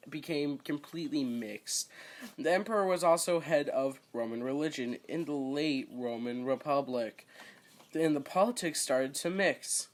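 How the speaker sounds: ordinary delivery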